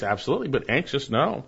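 normal talking voice

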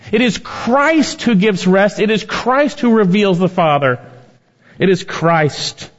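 high, slow, emphatic preaching; impassioned preaching. Real, not acted. Preacher proclaims that Christ gives rest, emphatically. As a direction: hammer each repetition, high and drawn out.

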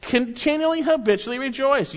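continually lifted high and stressed; stressed explanation; emphasized meaning. Shown as a demonstration. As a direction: hit continually with a high lift. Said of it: "present tense in the Greek".

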